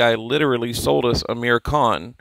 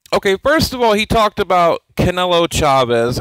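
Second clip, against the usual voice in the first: ordinary talk, nothing marked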